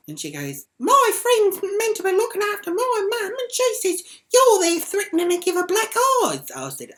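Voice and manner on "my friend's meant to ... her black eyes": high, loud mock shrieking; piercing mocking impersonation; mimicked irate daughter. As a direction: imitate her complaint at the top of your voice. Retold doorstep row, mimicking the daughter.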